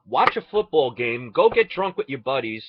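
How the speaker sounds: ordinary talk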